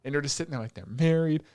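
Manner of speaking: ordinary talk, nothing marked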